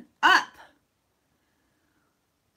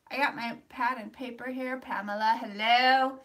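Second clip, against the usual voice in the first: ordinary delivery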